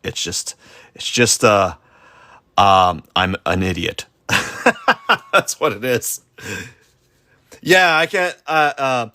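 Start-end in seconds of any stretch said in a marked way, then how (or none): 4.3-5.3 high-pitched burst of laughter; self-mocking laugh. Real, not acted. Laughs at calling himself an idiot.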